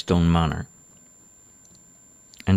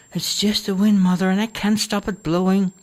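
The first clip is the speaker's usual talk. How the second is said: daughter's voice; 12 semitones higher than usual. Acted. Daughter calms mother.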